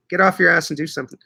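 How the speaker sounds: ordinary talk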